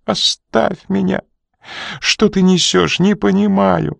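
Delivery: высоко и медленно, плача; умолять сквозь плач; стон со слезами. A narrator's reading. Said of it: "простонала она". (Рита плачет, вырываясь из рук Коридона)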